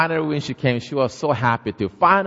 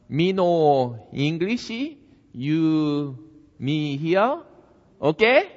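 put-on slow halting Korean-accented broken English; 4 semitones higher, much slower than usual. Korean grandmother explaining herself to her neighbor in slow broken English. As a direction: spell it out word by word.

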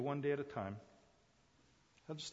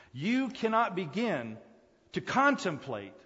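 much higher and louder pulpit fervor; preacher testifying about worship at dawn, high and loud; full-force preaching; 8 semitones higher, 10 dB louder than usual. Real, not acted. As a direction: lift the whole church with it.

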